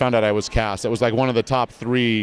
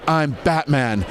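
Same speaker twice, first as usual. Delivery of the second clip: higher youthful heroic line; grown-up Robin as Batman; lighter Batman voice; 4 semitones higher, slower than usual. Shown as a demonstration. Recording the episode, lighter heroic Batman voice. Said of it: "when I did finally say".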